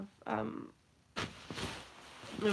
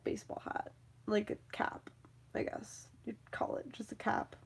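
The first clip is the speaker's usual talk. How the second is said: whispering; 8 dB quieter than usual. Real, not acted. Drops to whisper about cap.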